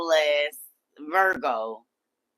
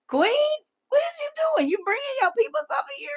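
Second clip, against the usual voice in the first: much higher, gleeful, fast at the end; excited squeal; much faster than usual. Real, not acted. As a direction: squeal with delight at a friend. Greeting excited chat newcomer.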